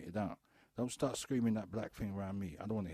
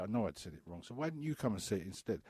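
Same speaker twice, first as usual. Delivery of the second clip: ordinary talk, nothing marked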